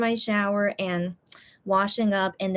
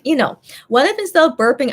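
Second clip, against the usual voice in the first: ordinary delivery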